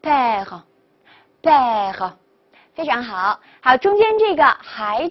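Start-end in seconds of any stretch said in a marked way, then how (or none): 0.0-2.1 "père" very slow, the p slightly softened towards b; blended model pronunciation. Shown as a demonstration. Teacher models the word for father, slow blended pronunciation. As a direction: draw out "père" as one word, pausing between takes. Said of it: "所以连起来就是".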